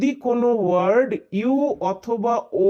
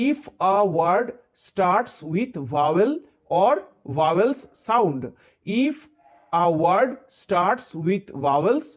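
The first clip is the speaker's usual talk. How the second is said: very slow, word by word dictation; 3 semitones lower, much slower than usual. Shown as a demonstration. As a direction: spell out the rule slowly for note-taking. Dictating rule slowly. (নোট লেখানোর জন্য খুব ধীরে ধীরে বলা)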